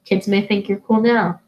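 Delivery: ordinary talk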